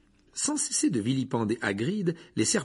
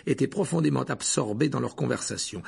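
usual speaking voice

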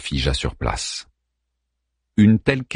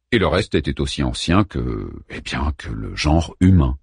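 plain reading voice

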